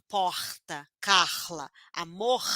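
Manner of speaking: Rio guttural R in porta, Carla, amor; Rio accent. Shown as a demonstration. Teacher showing how Rio pupils speak, Rio accent. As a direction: say them as in Rio, R breathed from the throat. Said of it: "lá no Rio de Janeiro".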